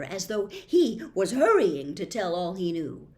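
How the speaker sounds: ordinary delivery, nothing marked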